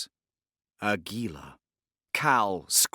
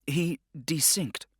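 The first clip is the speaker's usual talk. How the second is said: clipped; 7 semitones higher, slower than usual. A narrator's reading. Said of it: "she answered in a clipped voice".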